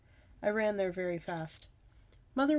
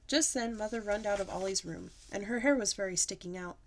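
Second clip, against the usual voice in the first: ordinary delivery, nothing marked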